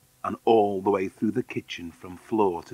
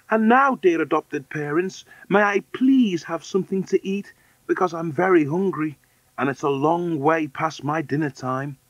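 put-on high little-girl voice; small, high voice asking nicely; polite hungry orphan girl; 7 semitones higher than usual. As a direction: plead politely in a raised pitch. Deedy asks for food.

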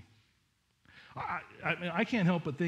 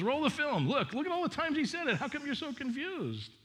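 much higher, animated mock-incredulous voice; exasperated angel; 11 semitones higher than usual. Angel pointing the women to Jesus' words, high and exasperated. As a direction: play an angel demanding they roll the tape.

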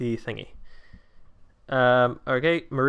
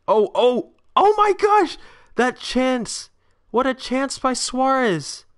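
high, loud shout at a missed chance; real fan outburst, slowed and stretched; excited shout; 9 semitones higher, 4 dB louder, slower than usual. Real, not acted. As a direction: yell it like a fan who just saw a sitter missed. Missed chance while watching match.

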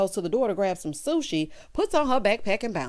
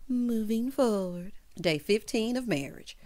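ordinary talk, nothing marked

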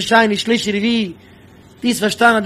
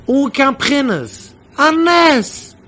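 higher-pitched, drawn-out emphasis; emphatic exclamation; 5 semitones higher, much slower than usual. Real, not acted. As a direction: exclaim it as a wonder. Exclaiming over his own miracle.

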